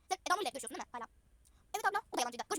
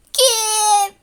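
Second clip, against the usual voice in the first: 1 villain reveal; 7 semitones higher, 16 dB louder than usual. Acted.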